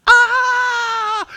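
a hammed-up cry of panic; panicked cry. King panics under Assyrian threat.

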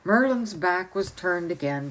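plain reading voice

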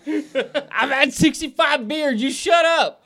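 high, slow, laughing mock drunk reply; hammed-up drunk father talking back; drunk dad retort. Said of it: "it's like".

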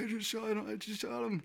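high, breathless-excited retold phone call; shaking excited voice. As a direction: squeak it out, re-enacting overexcited disbelief. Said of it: "your voice is, like, shaking".

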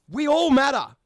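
loud emphatic. Real, not acted. Ranting to listeners, loud and emphatic. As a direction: punch it out loud, all of it.

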